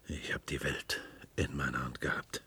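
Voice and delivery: whispered, low and soft; awed whisper. Acted. Clown whispering of his former power. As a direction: breathe it like a secret. Clown recalls lost glory.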